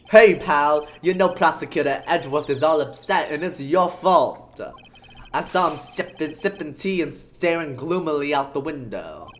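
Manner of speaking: loud, brash detective voice; gruff cop voice. Acted. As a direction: bark it like a clumsy cop.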